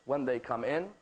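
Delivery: ordinary delivery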